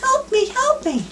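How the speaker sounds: very high, slow squeaky little voice; tiny voice. Retold or imitated. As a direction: squeak it like a tiny hidden creature. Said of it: "And the voice goes".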